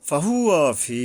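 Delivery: ফাহুয়া ফি slowly, long final i; one-alif stretch. Shown as a demonstration. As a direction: draw out the ফি in ফাহুয়া ফি. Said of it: "ফির উপরে এক আলিফ টানতে হবে". (ফাহুয়া ফি-র ফি এক আলিফ টেনে পড়া)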